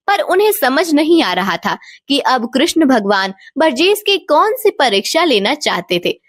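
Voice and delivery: ordinary delivery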